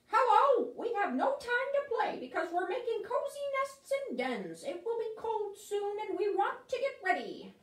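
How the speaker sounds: squeaky busy beavers and chipmunks; busy animals. Acted. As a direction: animals too busy to play, high squeaky voice. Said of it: "they say".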